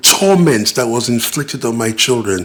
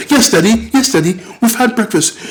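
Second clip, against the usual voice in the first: put-on much higher, eager child's voice; coached child's answer; 9 semitones higher than usual. Children lying to their father under the stepmother's watch, high and eager. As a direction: sing out the lie a child was told to say.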